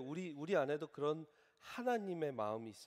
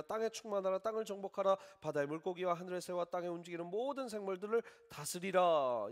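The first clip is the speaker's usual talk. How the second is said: fervent scripture reading; 6 semitones higher, 7 dB louder than usual. Acted. Pastor proclaiming Genesis to his congregation, fervent preaching. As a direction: read Genesis with full preaching force.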